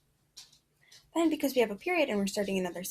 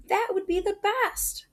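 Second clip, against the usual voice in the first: high, louder thrilled voice; excited child; 11 semitones higher, 4 dB louder than usual. Acted. Kay telling her mom about the trip, thrilled. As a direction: gush like a delighted kid. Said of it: "Kay exclaims".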